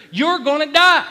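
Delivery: very loud, high cry of doom; full-force pulpit re-enactment; relentless dark thought. Retold or imitated. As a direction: shout it as the accusing voice in your head. Enemy's thought, at its peak.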